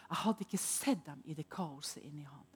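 awed whisper; whispered amazement. Real, not acted. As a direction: hush in wonder. Awed memory of his eyes.

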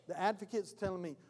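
ordinary delivery

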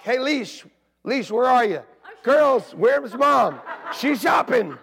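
loud calling for the wife, pitch up. Retold or imitated. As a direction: shout through the house for the missing wife.

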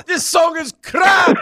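high, loud slow shout. Pretend. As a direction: bellow it out. Mock Scottish shouting.

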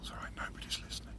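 fast hushed whisper. Real, not acted. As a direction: whisper it conspiratorially. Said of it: "Why are we whispering?".

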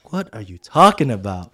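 loud, incredulous retort; mock-exasperated disbelief; baffled. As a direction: act out total confusion, loudly. Retold baffled reply to mentor.

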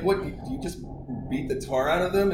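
high and loud disbelief; mock-aghast question; imitating shocked strangers; shocked parents. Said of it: "they were like".

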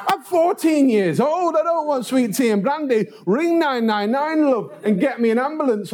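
very high-pitched mock indignation; exasperated teenage boy. Fourteen-year-old begging woman for ambulance, exasperated. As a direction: wail the protest in a high boyish voice. Injured boy rejecting tea and brandy.

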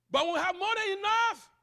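high, loud rising proclamation; preacher's cry. Real, not acted. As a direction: call it out across the church.

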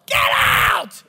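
loud, drawn-out imitated bellow; hammed-up furious shout; yelling old man. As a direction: roar it like an angry patient. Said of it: "And he's yelling".

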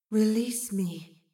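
slow, drawn-out eerie plea; otherworldly voice. A narrator's reading. Mysterious voice calling to Astrid, slow and eerie. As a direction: stretch the words out like a disembodied voice.

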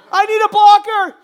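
loud, high call for a blocker; player calling for help. Acted. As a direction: shout for help from the field.

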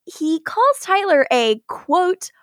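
normal talking voice

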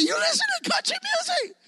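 very high, squeaky, amazed mailman voice; mailman's question. Retold or imitated. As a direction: play the mailman, pitch way up in disbelief. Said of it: "And he's like".

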